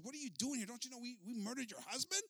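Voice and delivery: high-pitched indignant questioning; angry yelling. Acted. Chief demanding why the widow came, angry yelling. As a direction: raise the pitch and challenge her, acting angry. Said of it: "he starts yelling at her".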